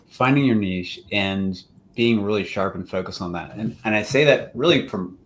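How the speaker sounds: ordinary talk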